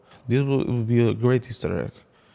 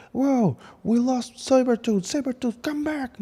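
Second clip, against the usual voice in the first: much higher, squeaky mock alarm; panicked teammate; 14 semitones higher than usual. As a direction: call after a runaway teammate in a high voice. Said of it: "someone from the team goes like".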